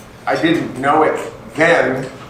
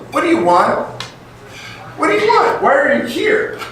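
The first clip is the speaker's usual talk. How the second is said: challenging the crowd; 10 semitones higher than usual. Acted. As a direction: push the audience to answer. Challenging the audience.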